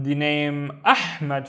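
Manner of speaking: usual speaking voice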